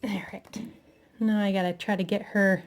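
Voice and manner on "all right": whispered to herself; whispered aside. Real, not acted. Crafter whispering to herself while fiddling with glue, quiet aside. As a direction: breathe it out under the breath.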